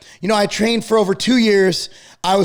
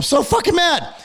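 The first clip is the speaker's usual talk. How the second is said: angry; 7 semitones higher than usual. Real, not acted. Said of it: "I'm yelling now".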